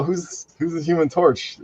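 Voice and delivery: ordinary talk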